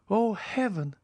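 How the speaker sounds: high exclamation of dismay; exclaiming. Acted. Julie exclaims at the count's words, high and dismayed. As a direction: throw it up as a young woman's cry. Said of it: "exclaimed Julie".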